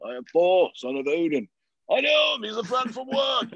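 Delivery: a loud, lightly voiced Thor; booming mock god voice; Thor impression. Retelling doing Thor for boxing friends, a hint of mock Thor. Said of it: "I started doing".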